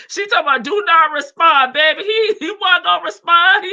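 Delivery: loud amused reaction. Real, not acted. Streamer reacting to a message, loud and amused. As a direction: crack up and exclaim about it.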